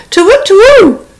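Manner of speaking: high hooting owl cry. Acted. Frightened owl hooting before flying off. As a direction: hoot it out in a fright.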